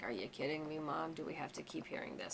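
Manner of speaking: exasperated daughter. Retold or imitated. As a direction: imitate a fed-up teenage daughter, half-hearted. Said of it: "Victoria's like".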